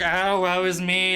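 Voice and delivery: mocking mopey brother. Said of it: "he's always like".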